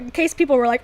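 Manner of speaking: usual speaking voice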